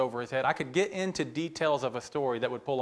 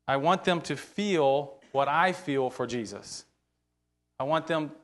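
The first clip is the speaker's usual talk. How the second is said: usual speaking voice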